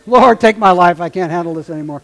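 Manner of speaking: loud exasperated plea to God, then hurried; a parent at the end of their rope; hammed-up despair; mock desperate cry. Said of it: "ever felt that way".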